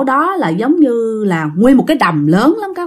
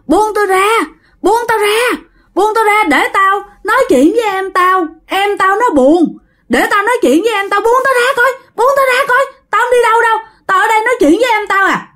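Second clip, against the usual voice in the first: much higher, frantic pleading to be let go; struggling and pleading; 10 semitones higher than usual. Retold or imitated. Brother held by cousins, begging to stay, much higher frantic plea. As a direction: fight them off and beg, voice pushed way up. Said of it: "dùng dằng mới nói rằng á là".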